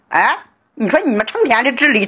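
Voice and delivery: ordinary delivery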